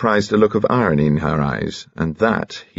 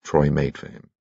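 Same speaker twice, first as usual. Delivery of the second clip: usual speaking voice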